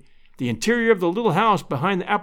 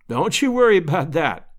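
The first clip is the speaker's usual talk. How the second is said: usual speaking voice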